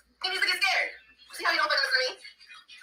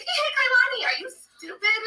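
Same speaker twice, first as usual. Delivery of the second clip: much higher squeaky mock voice; mocking commenters; slower than usual. Mocking her viewers' accusation in a high silly voice. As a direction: parody the viewers as silly and shrill. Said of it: "And you guys are like".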